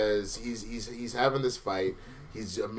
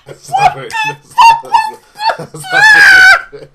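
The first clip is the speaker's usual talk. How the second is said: delirious laughing; 20 dB louder than usual. Real, not acted. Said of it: "I think I'm getting delirious".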